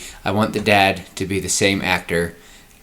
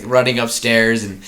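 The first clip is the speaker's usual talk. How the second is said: ordinary talk, nothing marked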